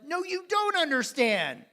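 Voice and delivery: high, loud burst of protest; an excited man loudly cutting off his coach; retold yell. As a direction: blurt it out in a loud, high cry.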